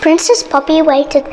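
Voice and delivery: plain reading voice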